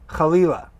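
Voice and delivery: chalila with a throaty ch, stress on li; Hebrew word. Shown as a demonstration. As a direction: say chalila with a rasped kh and a stressed lee. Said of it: "Here's the word".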